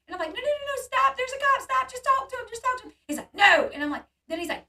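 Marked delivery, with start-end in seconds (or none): 0.3-2.9 very high, urgent, tumbling plea; retold panic, squeaking up high and loud; panicked pleading. Woman begging Sean not to run from cop, panicked. 3.3-3.7 loud, high one-word outburst; retold defiant shout; shouted refusal. Sean refusing to stop for the cop, shouted.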